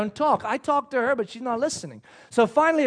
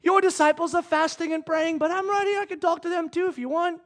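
higher-pitched, lightly pleading offer; acted pleading; 6 semitones higher than usual. Preacher as Jesus offering to talk to their disciples, high pleading. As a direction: offer it up high, after the outburst.

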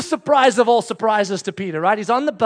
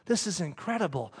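hushed, much softer voice; awed thinking; 4 semitones lower, 12 dB quieter than usual. Acted. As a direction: murmur it to yourself in quiet wonder. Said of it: "He's thinking. He's like".

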